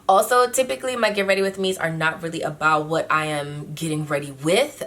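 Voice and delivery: ordinary talk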